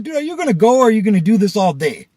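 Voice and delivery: loud, high exasperated yell; impatient outburst. Retold or imitated. Driver to man inching forward, impatient yell. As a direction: blow up at someone who keeps stalling.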